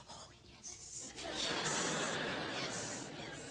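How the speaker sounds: breathy whispered swoon. Acted. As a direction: sigh it out dreamily.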